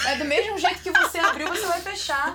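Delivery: high, loud, laughing mock retort; sassy comeback. Host mocking the host mother's line, laughing and sassy. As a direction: fire it back with a cheeky laugh.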